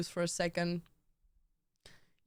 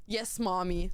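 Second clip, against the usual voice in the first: usual speaking voice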